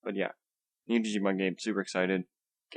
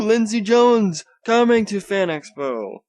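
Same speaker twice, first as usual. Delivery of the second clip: much higher, louder and slow hype; hyped slow announcement; 10 semitones higher, 7 dB louder, slower than usual. Shown as a demonstration. Vlogger hyping Fan Expo guests, slow, high and loud. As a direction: draw out the name like a big reveal. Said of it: "I'm trying to do this enthusiastically".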